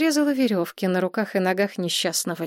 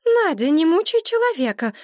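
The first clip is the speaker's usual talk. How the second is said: much higher, louder plea; Maria defending; 10 semitones higher, 5 dB louder than usual. A narrator's reading. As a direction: stick up for him, shrill with concern. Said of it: "вступилась за историка Мария". (Мария заступается, громче и выше)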